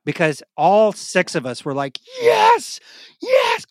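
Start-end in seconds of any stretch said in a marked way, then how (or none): 2.2-3.7 high, loud triumphant yes; cheering shout. Retold or imitated. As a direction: cheer like you've won. Group cheering at hammer.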